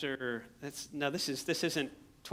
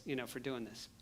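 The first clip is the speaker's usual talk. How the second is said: normal talking voice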